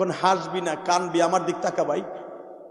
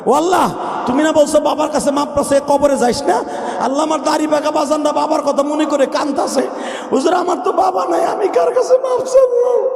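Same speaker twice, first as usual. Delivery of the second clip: high, loud anguished appeal to God; preacher wailing to God for the orphans; impassioned outcry; 9 semitones higher, 6 dB louder than usual. Real, not acted. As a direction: cry out on behalf of the fatherless. Preaching at full force.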